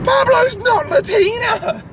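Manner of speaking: high, drawn-out squeal; mock outrage. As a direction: protest slowly in a high-pitched voice. Mock outburst about absent friend.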